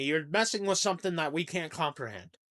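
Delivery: ordinary talk, nothing marked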